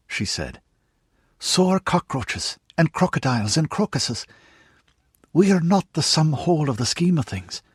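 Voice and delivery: Juniper, stern mother. A narrator's reading. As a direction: set her son straight with a firm lecture. Juniper lectures Rudy.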